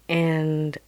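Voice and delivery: ordinary talk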